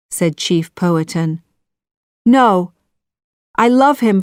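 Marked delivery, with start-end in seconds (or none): 2.3-4.2 defiant. A narrator's reading. Daughter defies father.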